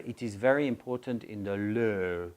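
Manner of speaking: imitated French learner's accent. French learner states what matters, French accent. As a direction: mimic a French speaker mispronouncing "law". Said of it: "sometimes French people say".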